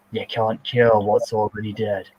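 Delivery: normal talking voice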